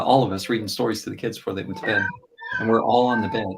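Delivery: normal talking voice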